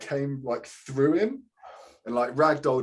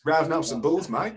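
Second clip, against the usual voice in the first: put-on Australian accent; 5 semitones higher than usual. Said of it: "Gotta do an accent".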